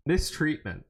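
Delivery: ordinary talk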